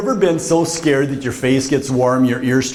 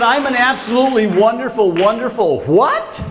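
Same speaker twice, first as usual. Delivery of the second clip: high-pitched, disbelieving repeat; incredulous echo; 8 semitones higher than usual. Retold or imitated. Student repeats the professor's praise, high comic disbelief. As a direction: echo it high, stretching the final question.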